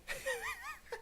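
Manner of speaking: high, helpless laugh. Real, not acted. Commentator amused by boyfriend's sudden small talk, real laughter. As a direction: burst out laughing. Laughs at the abrupt topic change.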